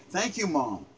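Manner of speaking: quiet, tender thanks to a mother; soft thank you. Pretend. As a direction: say it gently, just for mom.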